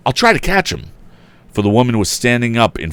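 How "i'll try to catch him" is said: calling out. A narrator's reading. Tom calling to the frightened woman, high call. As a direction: promise it over the noise. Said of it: "yelled the young inventor".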